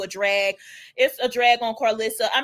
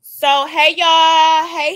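loud greeting; 4 semitones higher, 9 dB louder, much slower than usual. Real, not acted. Greeting the livestream chat.

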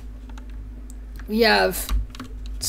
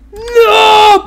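high, loud wailed "no"; hammed-up yell; mock falling cry; 12 semitones higher, 12 dB louder than usual. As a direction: cry out like someone shoved off. Voices victim pushed off.

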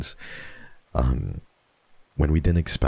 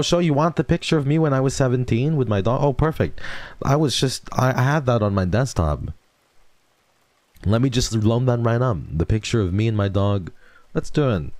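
higher, quick eager chatter; eager and upbeat; 7 semitones higher than usual. Real, not acted. Streamer answering chat's request for a photo, eager. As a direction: bounce along eagerly. Excited to share old photo.

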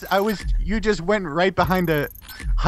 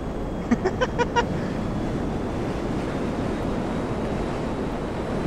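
high breathless laugh; 7 dB quieter than usual. Real, not acted. Laughs at opponent.

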